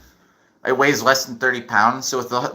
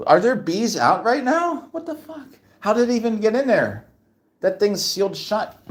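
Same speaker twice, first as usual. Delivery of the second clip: baffled disbelief; 10 semitones higher than usual. Real, not acted. Baffled by bee in cage.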